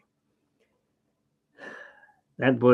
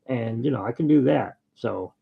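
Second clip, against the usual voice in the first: ordinary talk, nothing marked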